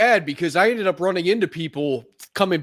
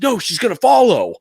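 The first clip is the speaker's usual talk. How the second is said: louder, higher retold protest; unsubtle protest; 5 semitones higher, 4 dB louder than usual. Imitating a friend warning they'll be followed, unsubtle and loud. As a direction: blurt it out with no subtlety. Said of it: "no subtlety about him".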